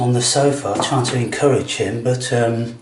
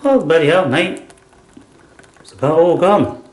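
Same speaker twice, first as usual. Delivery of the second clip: surprised; 5 semitones higher than usual. Real, not acted. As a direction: caught off guard, bottle emptied. Surprised bottle nearly empty.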